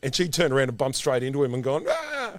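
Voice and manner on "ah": very high mock shriek; startled squeal, acted out; imitated high scream. Retold scream after bumping into Tom.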